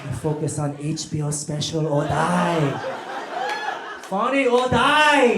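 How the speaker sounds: put-on earnest immigrant comic, mildly accented; light mock accent, dead serious ambition; lightly voiced North Korean comedian.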